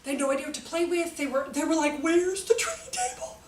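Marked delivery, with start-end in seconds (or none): 2.0-3.4 mock puzzled child. Kids asking for the train table.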